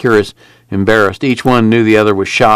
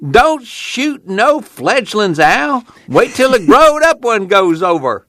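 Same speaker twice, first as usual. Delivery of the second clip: put-on high, drawn-out old man's holler; yelling grandpa; 12 semitones higher, slower than usual. A narrator's reading. Son retelling Grandpa at the airship, mock yell. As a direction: imitate grandpa hollering, pitched way up. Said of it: "Grandpa yells".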